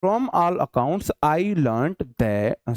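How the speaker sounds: teacher slowly reading the next question aloud; slow dictation. Shown as a demonstration. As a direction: read from all accounts I learned that very slowly. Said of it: "आगे देखो".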